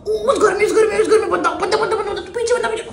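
high, loud, laughing callouts; excited gamer callouts. Retold or imitated. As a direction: shout callouts mid-game, giggling. Acting out PUBG callouts.

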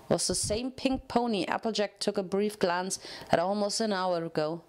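ordinary delivery, nothing marked

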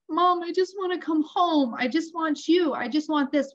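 put-on high, plaintive child's voice; pleading daughter. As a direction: imitate a homesick kid begging mom. Homesick daughter pleads on the phone.

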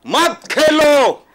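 real exasperated outburst, high and loud; angry shout. Real, not acted. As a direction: cut in shouting, words dragged out. Angry guest cuts in against playing cricket. (ग़ुस्से में चिल्लाकर)

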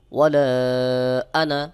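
ওয়ালা chanted long, আনা kept short; no stretch on আনা. Shown as a demonstration. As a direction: hold the end of ওয়ালা, then say both vowels of আনা short. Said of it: "এই আনায় টান হবে না". (ওয়ালা টেনে, আনা টান ছাড়া)

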